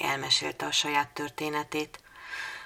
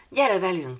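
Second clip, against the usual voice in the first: bright loud call to come along, pitched up; 6 semitones higher, 7 dB louder than usual. Acted. Children calling Anni to come with them, loud call. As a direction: call her over eagerly.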